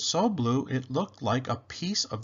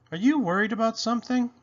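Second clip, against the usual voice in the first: quick worried mother; 9 semitones higher, faster than usual. Acted. Mama asks at bedtime.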